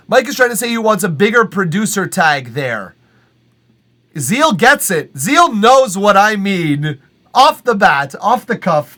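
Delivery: loud, high, hyped-up; excited. Real, not acted. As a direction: hype up the crowd. Excited hype about Zeal.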